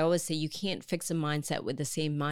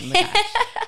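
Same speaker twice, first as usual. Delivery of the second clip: laughing; 11 dB louder than usual. Real, not acted. Joking ask for gift box.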